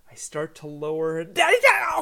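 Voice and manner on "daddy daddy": put-on sudden high, loud child's cry; imitated toddler scream. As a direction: burst out like a toddler being put down. Toddler screams again when lowered.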